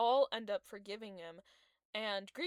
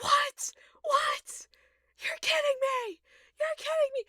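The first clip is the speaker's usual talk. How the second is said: very high, loud reenacted disbelief; losing it; 8 dB louder than usual. Fan reliving the shock of the ending, losing it. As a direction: squeal in outrage as if losing it. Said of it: "I was losing it".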